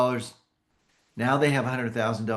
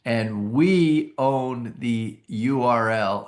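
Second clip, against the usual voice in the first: ordinary talk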